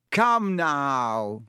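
hearty, drawn-out call to the room; beaming cry. A narrator's reading. Lockhart beaming at his gloomy class, buoyant cry. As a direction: buoyant teacher chivvying everyone along. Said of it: "he cried, beaming around him".

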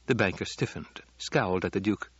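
plain reading voice

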